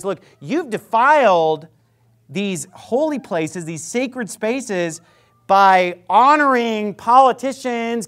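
loud, slow preaching, punching each phrase; fiery preaching. Acted. Preacher rebuking churches for honoring politicians, loud fiery sermon. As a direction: thunder it out from the pulpit.

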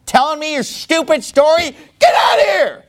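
high-pitched angry yelling; exasperated, high shouted rant; mad outburst. Acted. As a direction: yell at them to leave, outraged. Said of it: "he's just mad".

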